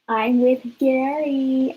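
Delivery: slow teasing drop of the news; taunting. Retold or imitated. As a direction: rub it in, drawing it out. Call Jazz and piss him off.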